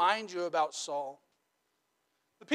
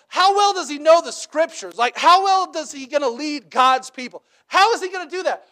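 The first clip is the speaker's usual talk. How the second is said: much higher and louder, disbelieving; exasperated Samuel; 8 semitones higher, 9 dB louder than usual. Retold or imitated. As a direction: press them hard like a fed-up prophet. Samuel questioning people, exasperated.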